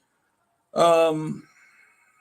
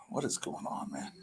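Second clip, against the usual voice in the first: whisper; 13 dB quieter than usual. Real, not acted. Frustrated at computer sound.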